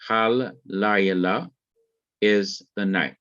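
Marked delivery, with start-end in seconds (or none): 0.1-1.5 "Hal Layla" with the L held long and slowly; doubled L. Shown as a demonstration. Teacher showing students the doubled letter, slow and held. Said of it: "Dagesh forte in Lameth".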